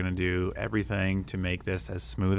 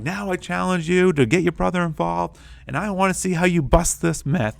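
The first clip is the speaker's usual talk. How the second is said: much higher, louder rallying call; playful challenge; 10 semitones higher, 7 dB louder than usual. Retold or imitated. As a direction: dare a child to take on the task. Teacher challenging student playfully.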